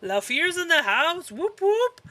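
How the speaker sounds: high, loud, drawn-out whoop; playful cheering. Pretend. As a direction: hype them up like a party crowd. Cheering a character's appearance.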